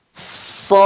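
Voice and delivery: sod with the vowel held three alif; drawn-out sod. Shown as a demonstration. Teacher reciting sod for students to copy. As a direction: hold sod long like a recitation. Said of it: "Sekali lagi".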